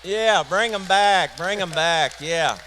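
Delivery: loud, slow call to bring the applause back; rally the crowd to keep cheering. Real, not acted. Comedian urging the audience to keep clapping, loud call.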